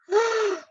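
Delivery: sharp high gasp of delight; mock gasp. Boyfriend clutching his chest, high gasp. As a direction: gasp as if struck in the heart. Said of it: "grabbed his chest".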